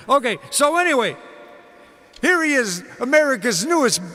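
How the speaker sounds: booming variety show introduction, slowing on the name; announcer intro. Acted. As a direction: build the crowd up for the star's entrance. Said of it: "announcer introducing [name]".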